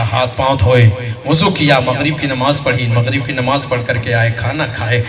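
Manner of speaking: usual speaking voice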